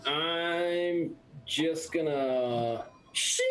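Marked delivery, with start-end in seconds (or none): 3.1-3.4 drawn-out metallic shing; vocal sound effect of a sword; sword-drawing sound. Acted. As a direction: imitate a blade sliding free.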